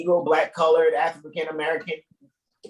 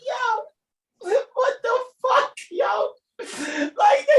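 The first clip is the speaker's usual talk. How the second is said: laughing shout; much slower than usual. Real, not acted. Cracking up at the song line.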